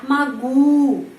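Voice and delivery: magu loud, stress on the U; stress demo. Shown as a demonstration. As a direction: hit the U in magu. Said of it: "penekanannya pada huruf U".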